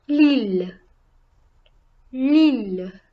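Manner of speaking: "L'île" said loudly on a prominent î; stressed circumflex vowel. Shown as a demonstration. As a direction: model "L'île" with a strongly stressed î. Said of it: "makes the vowel sound more stressed".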